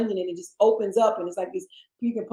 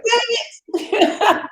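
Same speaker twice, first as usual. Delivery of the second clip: high, loud comic outburst; pitched-up exasperated yelp; mock exasperation; 11 semitones higher, 4 dB louder than usual. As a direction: burst out in laughing frustration.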